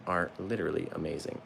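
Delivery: usual speaking voice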